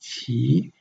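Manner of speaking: "Qi" sliding upward; rising tone qi. Shown as a demonstration. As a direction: lift the pitch through "Qi". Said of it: "the tone goes up".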